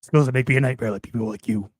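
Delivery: low, measured threatening voice; menacing movie threat. Acted. Host threatening the listener in a film parody, low menace. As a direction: drop the pitch and threaten them.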